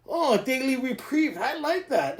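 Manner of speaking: ordinary delivery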